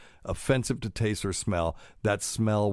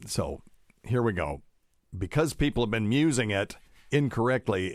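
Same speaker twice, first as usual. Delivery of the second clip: ordinary talk, nothing marked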